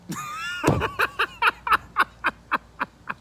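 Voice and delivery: long, squealing burst of laughter; high-pitched laugh. Real, not acted. Host cracks up during the intro, high laughing fit. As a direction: laugh hard, high and loud.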